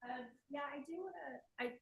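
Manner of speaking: hoarse, strained voice going out; losing her voice. Real, not acted. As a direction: speak through a failing, hoarse voice. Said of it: "I'm losing my voice".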